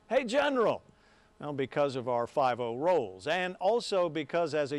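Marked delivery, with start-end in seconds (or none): 0.1-0.8 higher-pitched, playful call-out; playful yell. Retold or imitated. Sportscaster greeting Les at sporting events, playful yell. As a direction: call out teasingly to a pal. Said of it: "I'd playfully yell out".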